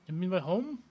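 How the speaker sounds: the vendor takes offence in a bad Indian accent. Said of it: "my really bad, uh, Indian accent".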